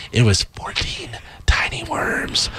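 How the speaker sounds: slow hushed whisper building suspense; dramatic whisper. Acted. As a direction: whisper the creepy detail. Gross-out reveal of the worm.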